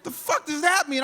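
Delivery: high-pitched exasperated disbelief; incredulous, voice shooting way up; baffled outburst. Acted. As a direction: blurt it out, totally lost. Baffled reaction to dad's advice.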